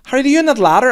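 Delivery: very high, loud mimicked call; mum shouting up. Other mum calling Harriet on the ladder, high mock shout. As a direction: imitate an impatient mum yelling up to her child. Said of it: "was shouting up".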